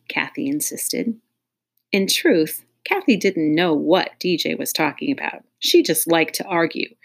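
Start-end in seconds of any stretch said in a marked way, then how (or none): none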